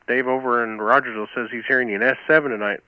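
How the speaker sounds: ordinary talk, nothing marked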